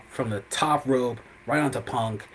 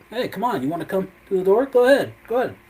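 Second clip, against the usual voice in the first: high, teasing come-and-get-it call; mocking taunt; 8 semitones higher, 5 dB louder, faster than usual. Rollins taunting Punk at the open cage door, high mocking dare. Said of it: "He's like".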